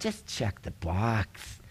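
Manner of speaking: low, slow, very soft nudge; hushed, slow persuasion; coaxing. Retold or imitated. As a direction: drop right down and quietly tempt them. Official coaxing, voice dropped.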